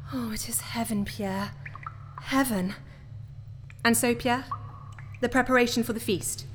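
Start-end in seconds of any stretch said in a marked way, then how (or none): 0.1-2.7 breathy, ecstatic whisper; hushed delight; rapturous whisper. Acted. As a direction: whisper it like a guilty pleasure. Savouring birds drowning in brandy.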